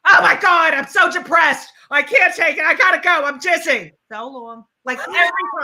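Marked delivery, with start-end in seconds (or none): 0.1-3.9 high, loud mock panic; mock hysterical mother. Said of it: "she was hysterical". 4.1-4.7 abrupt polite sign-off. Retold or imitated. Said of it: "so chatty at the end".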